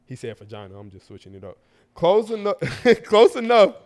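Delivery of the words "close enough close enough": higher, breaking into a real laugh; amused, laugh bursting through the words; laughing while reading. Real, not acted. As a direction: crack up mid-sentence while reading. Reader cracks up mid-letter.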